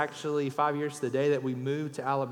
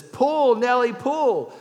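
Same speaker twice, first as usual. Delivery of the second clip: high, loud call to a horse; farmer's yell; 8 semitones higher, 9 dB louder, much slower than usual. Acted. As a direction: holler it out, drawing out the last word. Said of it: "he yells".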